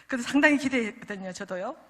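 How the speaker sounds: usual speaking voice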